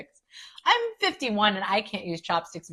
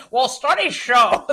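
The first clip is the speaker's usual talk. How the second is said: know-it-all parody; 3 semitones higher, 7 dB louder, slower than usual. Retold or imitated. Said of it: "I like your nerd voice".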